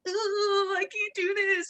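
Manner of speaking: high-pitched put-on whine; mock whining. Coach imitating people who doubt themselves, high mock whine.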